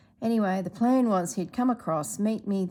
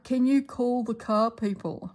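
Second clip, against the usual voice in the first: higher, louder imitation of a fretting dad; imitating Dad; 5 semitones higher, 4 dB louder than usual. Mimicking Dad on the phone, worried about his car. Dad quoted, ignoring her injury.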